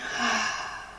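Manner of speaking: audible exhaled sigh; letting-go sigh. Shown as a demonstration. Teacher showing listeners how to let go, sighing out. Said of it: "let go with a sigh".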